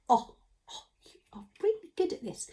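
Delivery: hushed praise; whispering. Real, not acted. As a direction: whisper it like a secret.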